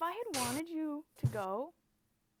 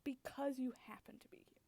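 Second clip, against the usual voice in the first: cold whisper; 3 semitones lower, 7 dB quieter than usual. Acted. Cold reveal to confused partner.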